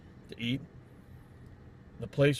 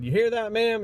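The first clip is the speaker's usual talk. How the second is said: excited callout; 9 semitones higher, 5 dB louder than usual. Retold or imitated. Salesman calls out to customer.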